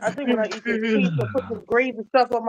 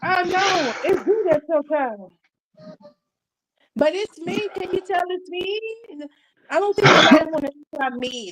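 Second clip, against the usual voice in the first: raised voice; 7 semitones higher than usual. Real, not acted. Answers viewer in a raised voice.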